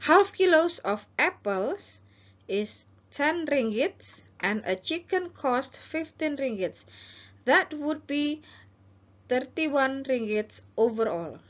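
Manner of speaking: slow, clear shopkeeper listing prices; seller role. Shown as a demonstration. Teacher as seller giving prices, slow and clear. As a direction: read the total out slowly. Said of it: "ini adalah penjual".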